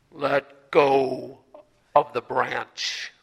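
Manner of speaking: God calmly instructs the dangling hiker; God's voice. Acted. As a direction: speak slowly like God from the heavens. God's voice answers from above.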